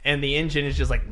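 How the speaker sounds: ordinary talk, nothing marked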